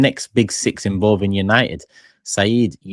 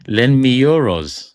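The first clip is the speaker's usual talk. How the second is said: louder, slow, drawn-out EUROS; emphatic repeat; 3 semitones higher, 7 dB louder, slower than usual. Real, not acted. Host rereads Hassan's comment after laughing, loud slow emphatic repeat. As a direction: savour the joke, stretching out euros loudly.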